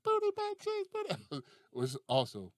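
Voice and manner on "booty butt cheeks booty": put-on very high little-kid sing-song; imitating young son. Son copies the song, high childlike chant. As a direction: pipe it up like a child copying dad. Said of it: "my son used to be like".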